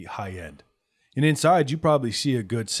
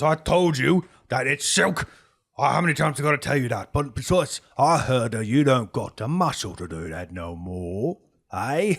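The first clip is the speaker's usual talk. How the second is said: higher, louder, mildly irritated boss; a little angry; 4 semitones higher, 6 dB louder than usual. Acted. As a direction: insist with growing irritation. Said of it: "He starts to get a little angry".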